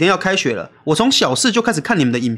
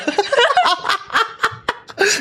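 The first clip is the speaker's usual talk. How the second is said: high laughter. Real, not acted. Laughing at the burp.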